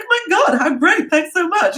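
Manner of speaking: very high, gushing, laughing delight; mock thrilled squeal. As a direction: squeal with over-the-top gratitude. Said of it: "And we're like".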